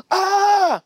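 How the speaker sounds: a high open "Ah!" cry; release shout. Pretend. Preacher demonstrating a cry of relief. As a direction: let go of the strain in one yell. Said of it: "and you just wanna go".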